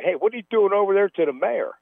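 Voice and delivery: quoted puzzled question. Retold or imitated.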